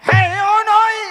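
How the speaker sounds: high, loud, exasperated correction. Acted. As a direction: call out that the pupil has it wrong. Teacher corrects pupil's wrong letter.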